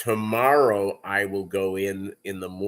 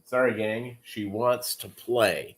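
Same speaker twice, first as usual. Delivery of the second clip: ordinary delivery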